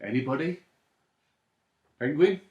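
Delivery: ordinary delivery, nothing marked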